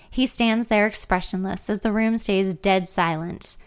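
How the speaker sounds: ordinary delivery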